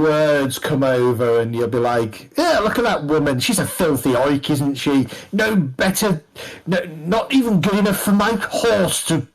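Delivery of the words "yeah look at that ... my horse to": higher-pitched haughty tavern gossip; sneering fop. Acted. Fop to companion, sneering about a woman. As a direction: play a snooty drinker mocking someone across the room.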